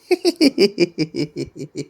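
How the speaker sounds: long high giggle; real, drawn-out high laugh; giggling. Real, not acted. Laughs while being teased.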